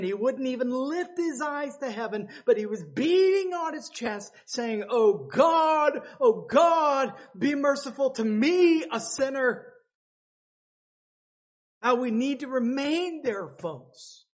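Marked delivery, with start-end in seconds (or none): 4.9-9.7 higher, louder desperate prayer for mercy; a sinner begging God for mercy; pleading cry. Acted. Publican praying, beating his chest.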